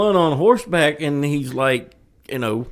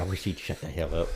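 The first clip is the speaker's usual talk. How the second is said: much lower, softer aside; imitated grumble; 10 semitones lower, 12 dB quieter than usual. Co-host voicing the fed-up friend's thoughts, low quiet grumble. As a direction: voice his silent wish, low and quiet. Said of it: "he's over there saying".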